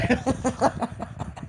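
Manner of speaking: breathy, quiet run of chuckles; trailing laugh at your own joke; soft, rapid chuckling. Real, not acted. Laughing at own dry bones image.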